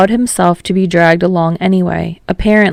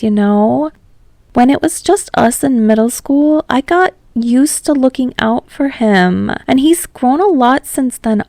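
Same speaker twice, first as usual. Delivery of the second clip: lightly voiced Ashido; 5 semitones higher than usual. A narrator's reading. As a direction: lift the pitch a little, half joking. Ashido voiced higher by narrator.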